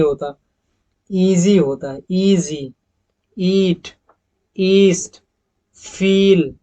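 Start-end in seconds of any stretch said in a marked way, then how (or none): 2.1-6.5 ईट, ईस्ट and फील with a long clear ee; long ee demo. Shown as a demonstration. Teacher reading example words to the class: long ee. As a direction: stretch the ee in each of ईजी, ईट, ईस्ट, फील. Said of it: "जो ई का जो sound आ रहा है".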